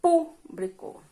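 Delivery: "público" with a high, drawn-out "pú"; antepenultimate stress. Shown as a demonstration. Teacher giving a proparoxítona example, "público" stressed on the first syllable. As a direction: hold "pú" high, then let "blico" fall away. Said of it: "A sílaba tônica é a antepenúltima".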